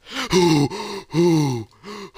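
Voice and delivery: high, loud, breathy whoops; high hooting gasps of joy; excited gasping hoots. Acted. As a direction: gasp in amazed delight. Hero sees the totem gone.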